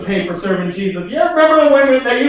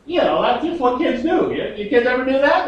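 usual speaking voice

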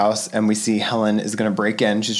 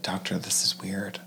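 softly worried aside; quiet; 4 semitones lower, 10 dB quieter than usual. Retold or imitated. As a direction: say it under your breath. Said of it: "Wouldn't you be like".

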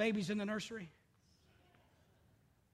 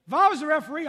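mock armchair referee; 6 semitones higher, 12 dB louder, faster than usual. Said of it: "Do it all the time".

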